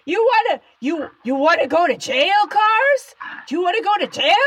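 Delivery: angry shout at the cars. Acted. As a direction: bellow it at the drivers honking behind you. Yells at the cars behind.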